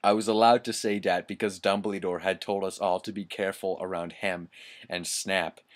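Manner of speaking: ordinary talk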